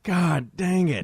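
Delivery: slow, drawn-out "God dang it" in a put-on DJ voice. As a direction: drag it out like a cranky radio host. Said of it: "now I'm doing [name]".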